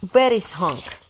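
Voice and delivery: put-on Russian accent, Hank said as Honk; a Russian boy asking, Honk for Hank; thick Russian accent. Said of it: "because of his thick Russian accent".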